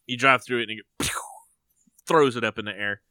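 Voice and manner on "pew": quick "pew" sound effect; mouth-made launch noise; imitated whoosh. Shown as a demonstration. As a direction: mimic hay shooting into the air. Imitates machine throwing hay.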